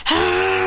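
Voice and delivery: long held mock battle cry; power-up cry. A narrator's reading. Student building up chakra, drawn-out power-up cry. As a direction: hold one long straining "ah".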